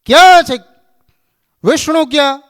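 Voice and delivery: high, loud furious yell; angry shout. Retold or imitated. Narad storming into Vaikuntha demanding Vishnu, furious shout. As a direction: storm in bellowing for him. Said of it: "Narad ખીજાણા".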